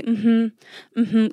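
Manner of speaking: nodding along. Retold or imitated. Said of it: "smile and nod and say".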